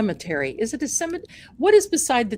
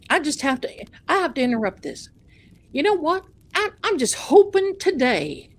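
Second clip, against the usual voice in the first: higher-pitched, insistent Southern pastor; mock Southern accent; 6 semitones higher than usual. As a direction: butt in as an imitated Southern preacher. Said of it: "he's got this Southern accent".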